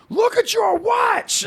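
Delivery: high, slow shouted heckle; mock yell. Friend demonstrating heckling from cars, shouting slowly. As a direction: holler it at a stranger. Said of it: "yell what they're doing, but louder".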